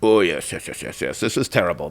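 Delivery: low, hushed commiseration; sympathetic French official. Retold or imitated. French official commiserates with the angry couple, sympathetic. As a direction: play an official soothing a complainant, voice dropped. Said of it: "And the guy's like".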